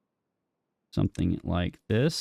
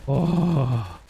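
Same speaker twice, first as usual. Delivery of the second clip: startled oh; 4 semitones higher, 4 dB louder than usual. Real, not acted. Blast goes off.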